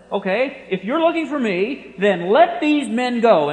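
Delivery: loud, slow commanding order. Retold or imitated. Preacher voicing Jesus ordering soldiers, loud commanding order. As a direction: give the order with full authority. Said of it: "now he says this".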